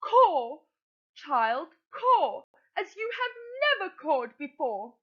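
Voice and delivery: high, eager, pushing mother crow; impatient Madam Crow. Acted. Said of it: "I'm going to put on a voice".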